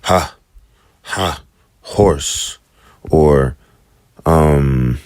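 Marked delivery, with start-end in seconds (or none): 0.0-2.6 "Ha" breathed like the h in "horse"; airy h on horse; h demo. Shown as a demonstration. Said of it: "or horse".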